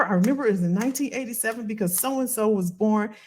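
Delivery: normal talking voice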